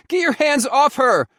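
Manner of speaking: high furious shout. A narrator's reading. Son shouting at his father to stop. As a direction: yell it at the father. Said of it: "I'd shouted".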